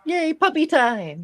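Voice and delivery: high, slow drawn-out squeal of joy; excited cheer. Real, not acted. Cohost cheering as the puppy break begins, high and excited.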